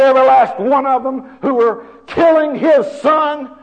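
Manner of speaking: high, loud, impassioned preaching shout; full-force preaching. Real, not acted. Preacher on God's power to destroy the killers, full-force shouting. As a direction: thunder it out to the congregation.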